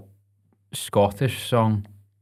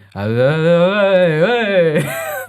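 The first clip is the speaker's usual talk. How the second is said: loud garbled hype shout, rising high; wordless crowd hype; 9 semitones higher, 10 dB louder than usual. Shown as a demonstration. DJ's trick to get a crowd cheering, wordless hype shout. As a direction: holler nonsense to rouse a crowd. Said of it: "if you kinda just".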